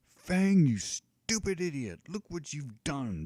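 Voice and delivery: a giant roaring at his dragon; bellowing giant. Acted. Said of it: "bellows the giant".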